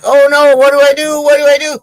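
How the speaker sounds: high, loud, fretful wail; mock panic. Gold digger to his partner after a snake bite, mock panic. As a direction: play a joke character panicking.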